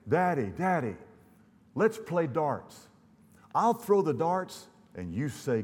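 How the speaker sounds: put-on little boy. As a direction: plead like an eager child to his daddy. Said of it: "The little boy".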